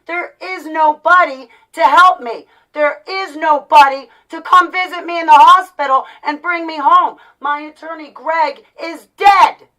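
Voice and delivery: loud, high, emphatic cry of grievance; distraught outburst. Real, not acted. Vlogger telling viewers there is no one to help, distraught and loud. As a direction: raise the voice hard, hammering the key words. Distressed rant about having nobody.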